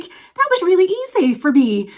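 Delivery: thrilled. Retold or imitated. Telling Billy after the stairs, thrilled. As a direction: announce it with giddy delight.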